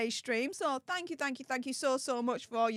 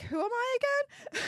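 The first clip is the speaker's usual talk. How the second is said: higher, airy mock disbelief; mock starstruck; 9 semitones higher than usual. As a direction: ask it as if stunned by sudden fame. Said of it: "I'm like".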